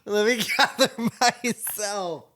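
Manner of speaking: real helpless, high tearful laughter in the voice; laughing to tears. Real, not acted. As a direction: try to compose yourself through giggles. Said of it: "Why am I in full tears".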